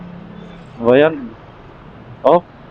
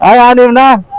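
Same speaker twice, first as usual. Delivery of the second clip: sudden high, louder delight; surprised; 10 semitones higher, 7 dB louder than usual. Real, not acted. Vlogger seeing his subscriber count jump, surprised. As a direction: caught off guard by good news.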